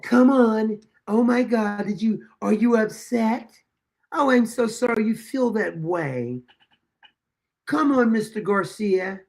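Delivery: mock gentle officer. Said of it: "Look how the officers are so gentle with him".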